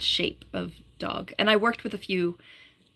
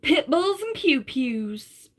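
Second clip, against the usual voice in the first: very slow, higher Southern drawl; slow Southern accent; 6 semitones higher, much slower than usual. Pretend. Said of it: "That's exactly how I should read it".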